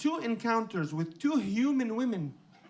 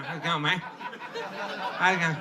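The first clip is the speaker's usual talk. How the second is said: slow, lazy outback greeting; broad Aussie drawl; 5 semitones lower, slower than usual. Retold or imitated. Families at the zoo greeting people, lazy drawl. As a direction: stretch it like a bush bloke.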